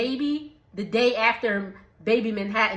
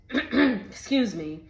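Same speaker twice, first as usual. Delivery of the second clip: ordinary talk, nothing marked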